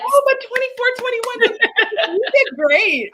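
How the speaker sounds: very high-pitched exclamation; high-voiced, animated consolation; excited sympathy. Real, not acted. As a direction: react in a high, encouraging squeal. Reacting to student's run-out sprinkler.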